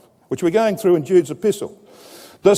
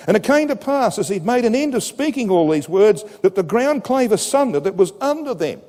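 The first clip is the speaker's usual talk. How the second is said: raised, measured reading voice; scripture reading; 7 semitones higher than usual. Acted. Preacher reading the verse aloud to the congregation, proclaiming.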